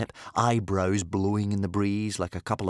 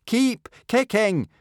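loud, high, slow shout; shouted motto; 6 dB louder, slower than usual. A narrator's reading. Hiccup shouting the motto for his father. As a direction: shout it out punching the air. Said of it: "shouted Hiccup".

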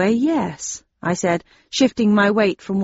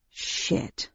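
soft unvoiced muttered curse; 11 dB quieter than usual. A narrator's reading. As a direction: breathe the curse under your breath. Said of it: "I muttered".

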